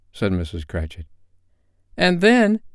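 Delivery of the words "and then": high, loud eager interjection; girl's cry. A narrator's reading. A daughter chimes in on her brother's prospects, crying out. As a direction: cry it out as an eager young girl. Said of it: "cried one of the girls".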